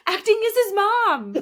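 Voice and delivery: loud, high emphatic echo; exclaimed agreement. Acted. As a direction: cry it out in delighted agreement. Emphatic echo of co-host's joke.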